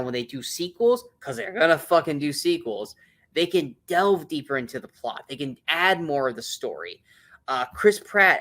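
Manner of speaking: ordinary delivery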